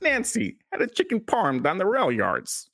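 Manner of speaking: high, exaggerated Chicago accent; comic Chicago accent on the edge of laughter. Shown as a demonstration. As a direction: ham up the Chicago vowels, pitched high. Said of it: "one more time, [name], please".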